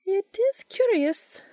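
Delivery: slow, high, musing witch voice; thoughtful. A narrator's reading. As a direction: ponder it aloud slowly. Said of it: "Rowena says thoughtfully".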